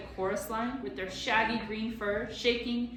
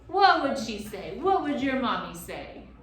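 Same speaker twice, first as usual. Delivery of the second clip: teasing creature; 4 semitones higher, 8 dB louder than usual. A narrator's reading. Gaboon teasing.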